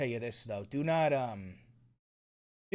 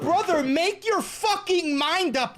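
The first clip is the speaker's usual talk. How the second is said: exasperated outburst; 9 semitones higher than usual. Real, not acted. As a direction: plead in outrage at the screen. Exasperated rant at the clip.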